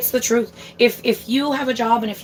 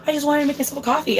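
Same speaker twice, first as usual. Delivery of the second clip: higher, quick mock-mom voice; imitating mom; 5 semitones higher, much faster than usual. Mom explaining herself to her kid, imitated higher and fast. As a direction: quote mom in a raised, hurried voice. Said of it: "she's like".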